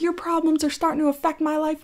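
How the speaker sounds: high-pitched mock complaint; mocking imitation. Imitating opponent in retold quarrel.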